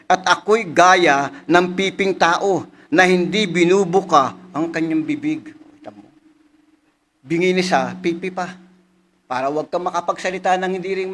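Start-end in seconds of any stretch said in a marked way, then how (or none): 0.1-5.5 louder, slow solemn reading; elevated preacher's reading; scripture reading. Acted. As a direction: proclaim the psalm to the congregation. Reads a psalm aloud.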